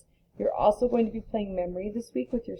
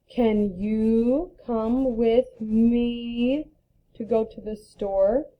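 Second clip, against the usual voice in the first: very slow, even reading of the sentence; slow example read; much slower than usual. Shown as a demonstration. Teacher reading an example to class, slow and deliberate. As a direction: read it out slowly for young learners.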